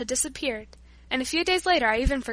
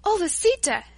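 higher, louder delighted exclamation; exclaiming; 7 semitones higher, 4 dB louder than usual. Acted. Paula at the window calling Lisita, delighted and higher. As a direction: gasp it out in wonder. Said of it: "she exclaimed".

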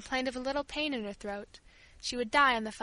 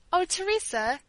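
higher-pitched mild exclamation; exclaiming; 6 semitones higher than usual. A narrator's reading. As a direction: break in with a raised "oh". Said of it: "exclaimed Rosa".

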